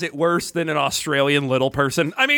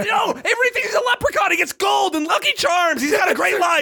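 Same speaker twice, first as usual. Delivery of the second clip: high laughing yell; laughing shout; 10 semitones higher than usual. Real, not acted.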